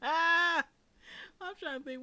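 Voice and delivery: high, loud laughter running into words; laughing. Real, not acted. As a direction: crack up and keep talking through it.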